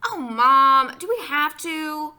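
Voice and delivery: loud, high, drawn-out whine; exasperated child's complaint; whining protest. A narrator's reading. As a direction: groan it like a put-upon kid. Boy protests mother's request.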